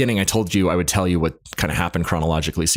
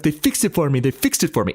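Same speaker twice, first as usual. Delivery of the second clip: put-on insistent thick Armenian accent, pitched up; 9 semitones higher than usual. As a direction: plead with the cop in a heavy Armenian accent. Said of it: "He's like".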